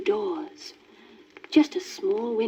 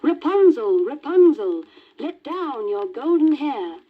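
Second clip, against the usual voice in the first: put-on louder, higher young prince calling up; 6 semitones higher, 4 dB louder than usual. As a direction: cry out hopefully to the tower. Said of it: "the prince approached and cried".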